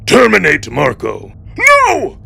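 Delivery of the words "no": high piercing cry of no; desperate scream. Acted. Marco begging the drones to stop, a high scream. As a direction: scream it in panic.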